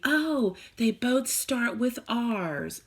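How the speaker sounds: high, slow, drawn-out mock first grader; first grader's answer. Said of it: "let me tell you a first grade answer".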